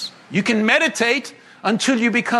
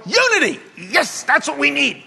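higher, eager cry of Unity; mock eager churchgoer; 3 semitones higher than usual. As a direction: cheer it like a naive fan. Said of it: "most people in the church go".

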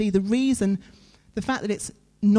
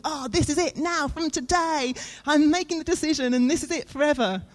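high-pitched, gushing declaration; mock-fervent vow, pitched way up; excited resolve; 7 semitones higher than usual. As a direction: proclaim it like a keen new convert. Acting out a believer's keen resolution.

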